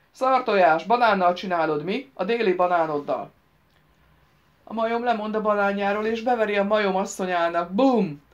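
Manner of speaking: put-on loud, crude drunken taunt; acted rowdy mockery at full volume; loud jeering. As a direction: jeer at him loudly, building to a booming last word. Drunk boys loudly taunt Réni.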